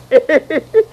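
gleeful chuckle. Acted. Delighted laughter.

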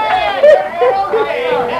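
joyful cackle. Acted. Celebration laughter.

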